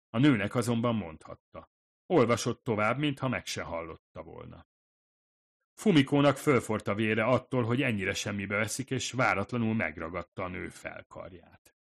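ordinary talk, nothing marked

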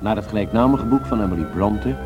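ordinary talk

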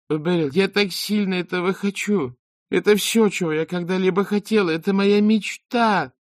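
high, gushing breathless dreamer; mocking, high voice of the singer; mock dreamy girl. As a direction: gush like a starry-eyed hopeful. Beril mimics the girl's dream.

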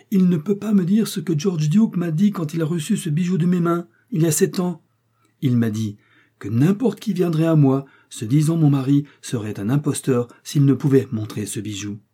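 high, insistent accusation; impassioned denunciation. A narrator's reading. Millicent exposing the man as an impostor, impassioned.

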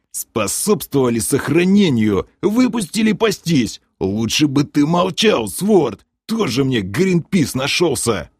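gruff, derisive echo of a pompous speech; growled mockery. A narrator's reading. Varama scolding his comrade for speechifying, growled mockery. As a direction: throw the words back with scornful emphasis. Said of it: "басовито рыкнул Варама".

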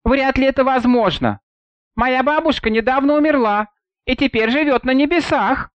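a hint of a young girl. A narrator's reading.

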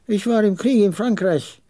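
put-on high-pitched old veteran; high, emphatic character voice; old camel trader. Slightly irritated old man recalls war.

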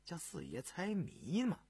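low, soft start, lifting into the question; gentle teasing. A narrator's reading. Prince prods official who stopped halfway, gently teasing. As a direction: coax out the rest with quiet amusement. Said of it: "偏着脸笑道".

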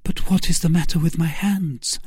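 high-pitched woman's role, puzzled; questioning Milady. Acted. Milady asking Felton about her hands, high and puzzled. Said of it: "asked Milady".

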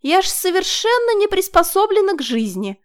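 put-on high, girlish, apologetic whine; excusing herself. A narrator's reading. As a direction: plead helplessness cutely. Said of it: "словно оправдываясь".

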